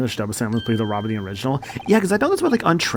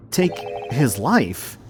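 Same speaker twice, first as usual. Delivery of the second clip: stunned repeat; much slower than usual. Acted. Repeats the words in disbelief.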